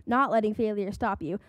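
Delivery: usual speaking voice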